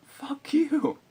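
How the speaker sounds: high, loud retort flung at the teacher; defiant high yelled comeback; mock defiant shout. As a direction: snap it back high and loud. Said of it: "And I'm like".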